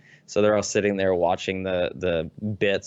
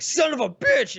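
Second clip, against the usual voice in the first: much higher, louder mock curse; angry outburst; 4 dB louder than usual. Imitating the fan raging at the plant, much higher. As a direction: explode in fake fury. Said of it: "and he's just like".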